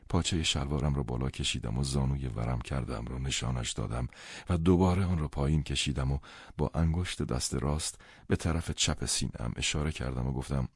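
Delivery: plain reading voice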